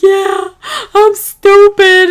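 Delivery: high, loud wailing cry of I'm stupid, drawn out slowly; hammed-up sob of defeat; crying admission. Believer crying to God, high loud wail. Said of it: "You're like".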